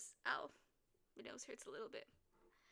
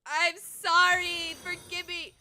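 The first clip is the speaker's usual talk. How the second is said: slow, stretched mock plea; drawn-out apology; 3 semitones higher, 7 dB louder, slower than usual. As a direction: stretch the sorry like a hammed-up plea.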